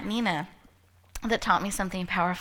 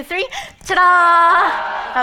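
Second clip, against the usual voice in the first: loud, slow, drawn-out ta-da; big showman ta-da; ta-da shout; 8 semitones higher, 12 dB louder, much slower than usual. Shown as a demonstration. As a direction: stretch it out like a grand reveal. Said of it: "On the count of three".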